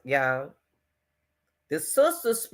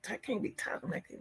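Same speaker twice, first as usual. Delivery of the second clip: breathless whispered aside; 3 semitones higher, 15 dB quieter, faster than usual. Real, not acted. Vlogger whispers an aside while the clip plays, hushed. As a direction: mutter it to yourself, barely audible.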